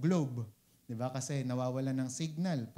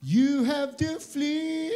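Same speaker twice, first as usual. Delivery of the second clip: high, loud, slow, sung-out rebuke; mock fiery rebuke; 8 semitones higher, 6 dB louder, much slower than usual. Preacher imitating a fiery rebuke against darkness, sung out. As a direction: cast it out like a revival preacher.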